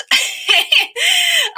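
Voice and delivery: high shrieking villain cackle. Acted. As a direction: let out a shrill shrieking laugh. Villain laughing at others.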